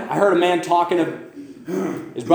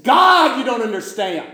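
high, loud cry on God, easing off; retold cry to God, starting high and loud; anguished cry out; 8 semitones higher, 3 dB louder than usual. Preacher acts out a man crying out to God, high anguished cry. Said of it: "I cried out and said".

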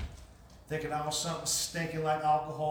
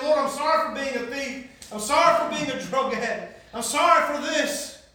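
loud, high, slow cry to God; pleading confession; 7 semitones higher, 8 dB louder than usual. Retold or imitated. Dale at the altar confessing to the Lord, pleading confession. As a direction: cry out each apology, raised and drawn out. Acted altar prayer, crying out.

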